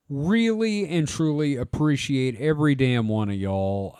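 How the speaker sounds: usual speaking voice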